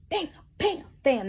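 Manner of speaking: high spoken bam bam sound effect; hitting sounds. Retold or imitated. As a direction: punch out each bam like a hit. Acting out hitting him.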